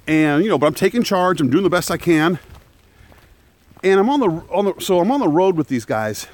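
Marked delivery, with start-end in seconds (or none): none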